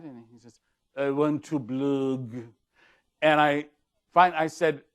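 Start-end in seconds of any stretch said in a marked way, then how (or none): 1.0-2.3 put-on slow Clouseau-style French accent. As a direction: mimic a French client like Inspector Clouseau. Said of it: "Peter Sellers Inspector Clouseau moment".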